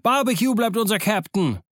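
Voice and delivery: high, rousing pirate cheer; excited chant-like crew cries. Acted. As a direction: acclaim your captain with full excitement. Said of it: "riefen sie".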